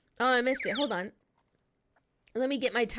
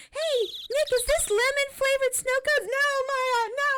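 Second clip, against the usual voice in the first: put-on higher, bubbly teenage girl voice, teasing; Maya character voice; 4 dB louder, slower than usual. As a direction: play the cheeky girl sidekick, pitched up. Voicing Maya, then Nick warning.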